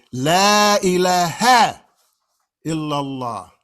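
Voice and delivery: "La ilaha" recited slow and loud, la held long. Shown as a demonstration. Teacher reciting the declaration to his class, slow and loud. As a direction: chant "La ilaha illallah" with a clipped final ha. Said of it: "you see that red H there with the fatha".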